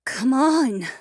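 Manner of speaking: high, urgent woman's voice; breathy woman's voice. A narrator's reading. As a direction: urge him on, impatient. Narrator voices Alessia, urging.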